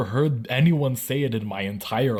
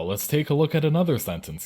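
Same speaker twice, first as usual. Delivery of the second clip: ordinary talk, nothing marked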